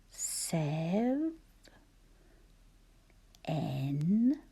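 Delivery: "Sev" and "N" with a long gap between; syllable by syllable. Shown as a demonstration. Teacher dictating seventh for spelling, "Sev" and "N" said separately. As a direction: sound out "Sev", pause, then "N". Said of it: "Think about those sounds and syllables".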